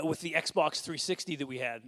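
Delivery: normal talking voice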